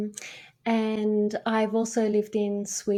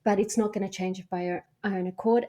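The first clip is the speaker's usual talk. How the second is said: ordinary talk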